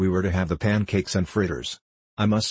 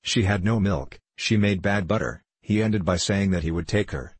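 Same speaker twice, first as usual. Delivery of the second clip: usual speaking voice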